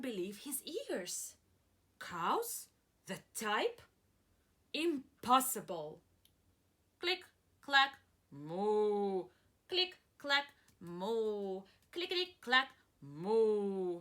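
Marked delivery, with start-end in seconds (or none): 2.0-6.0 slow, louder incredulous cry; disbelief. Acted. Farmer Brown doubting the typing cows, incredulous. 7.0-14.0 clack and moo like the farm; typing and mooing sounds. Acted. Teacher reading the noise refrain, playful sound effects.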